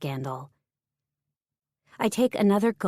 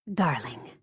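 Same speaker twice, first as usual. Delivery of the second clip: breathy Margot; 6 semitones lower, 4 dB quieter than usual. Acted. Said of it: "I hear her breathy voice".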